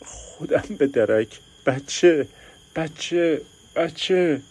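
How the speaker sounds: rising high anguished cries; growing panic for the baby; desperate cry. Acted. نگرانی برای بچه در درد. (فریاد نگران و رو به اوج)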